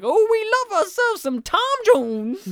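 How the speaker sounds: put-on high swooning old lady; church lady voice. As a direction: swoon it like a smitten granny.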